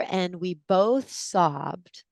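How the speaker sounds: ordinary talk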